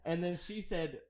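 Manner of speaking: talking through laughter; laughing. Real, not acted. Telling his brother about the pecan mixup, laughing. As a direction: laugh your way through the words. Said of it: "laughing about pecans".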